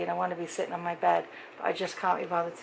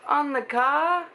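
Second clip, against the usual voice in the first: surprised; 8 semitones higher, 5 dB louder, much slower than usual. Retold or imitated. Pete's surprised question.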